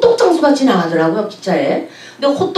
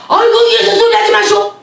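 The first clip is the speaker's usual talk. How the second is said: guide brushing her off; 9 semitones higher than usual. Retold or imitated. Guide refusing to hear about Jesus, high brush-off. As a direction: wave off talk of Jesus sharply. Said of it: "막 그래".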